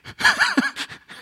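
high delighted laughter; healed leper laughing with joy; joyful laugh. Retold or imitated. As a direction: laugh in pure amazement.

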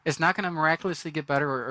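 usual speaking voice

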